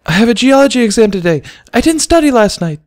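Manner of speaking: frantic high-pitched worry; panicking. Acted. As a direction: blurt it out in a panic. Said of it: "panicking as she came down".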